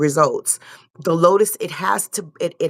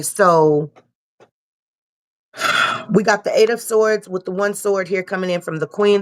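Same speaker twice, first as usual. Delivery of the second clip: ordinary talk, nothing marked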